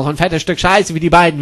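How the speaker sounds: ordinary talk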